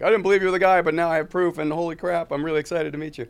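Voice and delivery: high, loud gushing; excited fan. Pretend. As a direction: gush like a starstruck fan.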